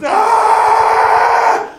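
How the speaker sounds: loud high anguished wail; wailing cry. Retold or imitated. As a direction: howl out the grief at the bananas. Weeping loudly by the bananas.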